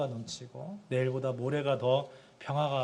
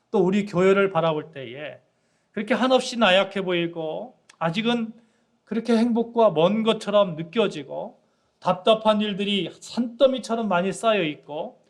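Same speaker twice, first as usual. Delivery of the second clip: louder, higher preaching build; full-force preaching; 4 semitones higher, 5 dB louder than usual. Acted. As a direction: proclaim it to the congregation with fire. (설교 절정에서 회중에게 힘차게 외치듯)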